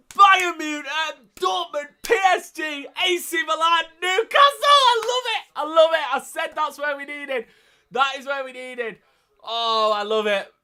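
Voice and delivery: very high, loud screaming celebration; real euphoric roar at the draw; ecstatic shouting. Real, not acted. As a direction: yell it out in sheer delight. Celebrates Newcastle's group of death.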